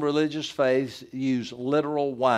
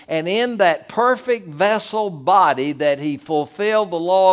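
louder, higher rising preacher cadence; preaching at full force; 4 semitones higher, 6 dB louder than usual. Acted. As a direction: build the sermon to a fervent climax. Pastor builds to full preaching.

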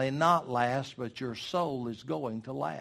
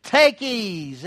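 loud, higher, self-satisfied proclamation; rich fool's boast; 6 semitones higher, 11 dB louder, slower than usual. Acted. Rich man gloating to his soul, loud and slow. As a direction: crow it to your own soul. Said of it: "you see how he said it?".

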